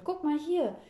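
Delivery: high, loud, eager urging; excited. A narrator's reading. Girl waving the newspaper at grandpa, excited. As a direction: demand a look, bursting with news. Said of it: "wedelt aufgeregt mit der Zeitung".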